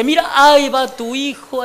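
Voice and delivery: loud, high, drawn-out proclamation; hammed-up priestly exclamation; mock-rapturous announcement. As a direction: proclaim a fake vision with showy wonder. Priest proclaims fake vision of son.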